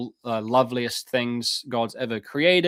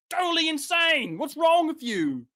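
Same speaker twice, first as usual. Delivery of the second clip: high shouted sarcastic jab; mock outrage. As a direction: shout it like a scandalized critic. Mocking critics of his view.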